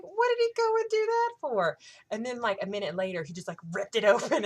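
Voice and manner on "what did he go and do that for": very high, loud wail; outraged complaint. Retold or imitated. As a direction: squeal it in dismayed disbelief. Fangirl complaint to friend, acted.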